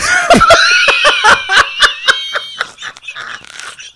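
high shrieking laugh; loud laughing shriek. Real, not acted. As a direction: howl with laughter. Loud laughter after own joke.

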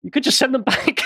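laughing through the words, pitched high; real laughter. Real, not acted. Host concedes co-host's joke about deporting to England, laughing helplessly. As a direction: talk while cracking up.

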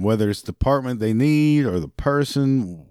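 normal talking voice

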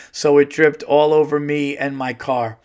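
ordinary talk, nothing marked

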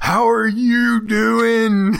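high, loud, drawn-out cowboy voice; Elmer impression. Podcaster tries out a new go-to voice for meeting people, Elmer impression. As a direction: stretch each word in a mock Western twang. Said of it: "my new go-to voice".

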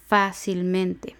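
"Fácilmente" slowly, leaning on "Fá"; stress on fa. Shown as a demonstration. Teacher showing where the accent falls, stress on "Fá" in "Fácilmente". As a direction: draw out "Fácilmente", accent on its first syllable "Fá". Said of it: "la anterior a la antepenúltima es fa".

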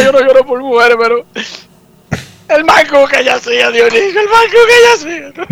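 hammed-up high, wailing lovesick sobs; mock crying. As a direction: wail about her like a jilted drunk, overdone. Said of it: "llorando desde temprano".

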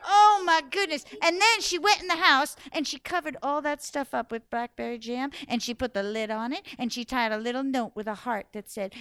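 ordinary delivery, nothing marked

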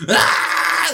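high, harsh scream; hammed-up scream of agony; mock scream. Villain being eaten by his young, mock agonised scream.

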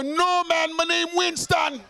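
high, loud mock Jamaican accent; fake Jamaican accent. Dodging the bishop with a loud fake Jamaican accent. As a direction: put on a hammed-up Jamaican accent, shouting the name. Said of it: "use a fake Jamaican accent".

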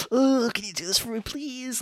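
high, whiny demanding customer. Retold or imitated. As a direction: whine it like a rude shopper. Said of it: "so it's like".

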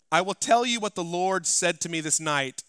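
impassioned pulpit delivery; full-force preaching. Acted. Preaching the scripture.

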